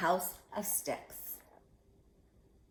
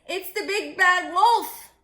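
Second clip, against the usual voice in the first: frightened cry; 11 semitones higher, 10 dB louder than usual. Acted. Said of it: "cried Horace".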